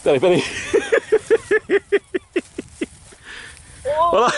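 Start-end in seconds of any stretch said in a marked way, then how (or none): 0.5-2.9 long high-pitched laughter. Real, not acted. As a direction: giggle helplessly. Friend mishandles fish.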